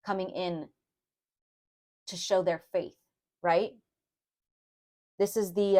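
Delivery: normal talking voice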